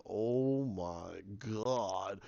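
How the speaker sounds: slow, soft, stunned mock horror; horrified oh my god. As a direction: draw it out, quiet and aghast. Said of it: "Mercy's sitting there going".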